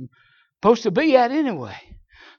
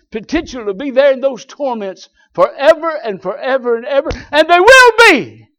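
ordinary delivery

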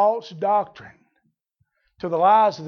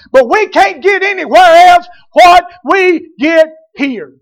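higher and louder, shouting the congregation's voice; preaching at full force; 7 semitones higher, 11 dB louder than usual. Acted. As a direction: preach it out to the rafters.

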